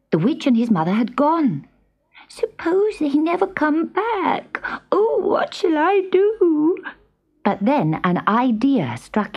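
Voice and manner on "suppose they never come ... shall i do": high, slow, plaintive little voice; tearful kitten. A narrator's reading. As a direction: fret like a small kitten left all alone. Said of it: "And he stopped crying".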